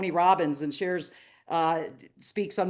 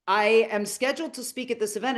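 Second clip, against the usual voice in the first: usual speaking voice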